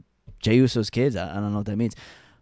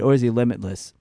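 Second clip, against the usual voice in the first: normal talking voice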